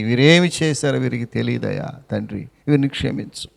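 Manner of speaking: low, hushed intercession; Christ's gentle plea for his accusers, softly; soft pleading. Acted. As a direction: quietly beg the father to forgive them.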